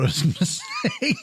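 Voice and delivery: words broken by a laugh. Real, not acted. Host losing their thought after a mouthful, laughing. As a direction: crack up just before the last word.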